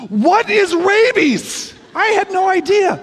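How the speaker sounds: put-on a puzzled child asking, stretched out; clueless little kid. Retold childhood dialogue with buddy.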